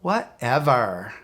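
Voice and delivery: put-on slow, drawn-out valley girl whatever. Shown as a demonstration. Coach shows the password as a slow valley girl. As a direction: stretch it like a bored valley girl. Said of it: "Let's say it like a valley girl".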